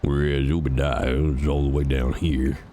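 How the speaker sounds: ordinary talk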